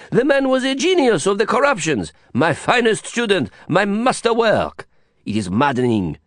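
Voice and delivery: a Frenchman hamming up his outrage at a friend's virtue; mock disgust, French Didier. A narrator's reading. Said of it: "clearly disgusted".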